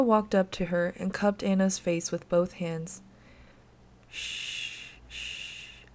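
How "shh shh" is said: soft unvoiced hushing; soothing whisper. A narrator's reading. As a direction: hush her gently to calm her. Comforting a frightened child.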